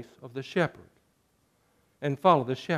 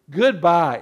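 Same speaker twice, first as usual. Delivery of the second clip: ordinary delivery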